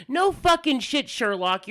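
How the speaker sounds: fed-up comeback to an absent tech; exasperated retort. Acted. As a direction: snap back sarcastically at the obvious. Retort to retold tech's instructions.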